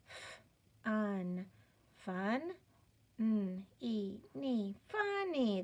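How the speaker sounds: "F" as a bare consonant, then "un", "fun"; sounding out. Shown as a demonstration. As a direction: build "funny" piece by piece, "fun" then "ni", stretching the blend. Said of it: "figure out what this syllable is".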